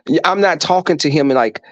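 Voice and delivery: ordinary talk, nothing marked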